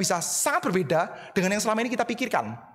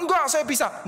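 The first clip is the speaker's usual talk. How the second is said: higher, stubborn protest; Peter insisting; 7 semitones higher than usual. Acted. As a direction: insist you can do it.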